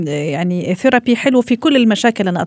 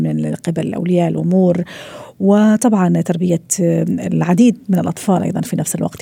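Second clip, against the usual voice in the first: slightly softer, measured presenter read; segment intro read; 4 semitones lower, 3 dB quieter than usual. Acted. Radio host opening the parenting segment, measured read. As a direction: introduce the new segment calmly to listeners.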